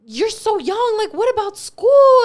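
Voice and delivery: high, loud mock scolding from mom; mom going off. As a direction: shriek it like an outraged mother.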